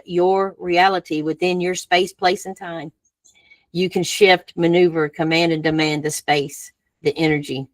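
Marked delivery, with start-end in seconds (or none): none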